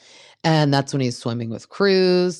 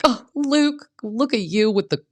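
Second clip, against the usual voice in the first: much higher, teasing admiration; gushing mock coo; 10 semitones higher than usual. Recapper teasing Luke's proposal line, high gushing coo. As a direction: coo at him like he's adorable. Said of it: "I'm like".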